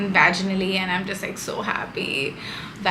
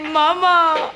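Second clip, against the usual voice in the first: higher, louder mock baby "Mama"; mother imitating her baby's first "Mama" in a higher voice; 8 semitones higher, 5 dB louder than usual. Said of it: "She said".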